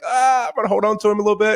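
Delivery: a playful hint of a fretting dad; protective dad. Dad wanting to shield his son, mock fretting.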